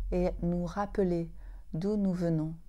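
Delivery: ordinary delivery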